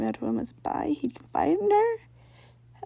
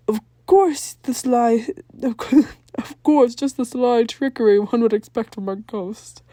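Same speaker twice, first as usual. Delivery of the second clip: gasping realization; 2 semitones higher, 8 dB louder than usual. Acted. Jack realizes the ghost tricked them, excited gasp. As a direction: seize on the idea with excited delight. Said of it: "Jack gasped".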